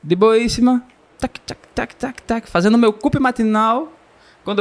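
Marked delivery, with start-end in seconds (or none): 1.2-2.5 light high tac tac of footsteps, soft; footstep clicks. Retold or imitated. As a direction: tap out the steps with the tongue. Imitates his footsteps.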